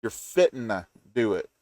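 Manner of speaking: normal talking voice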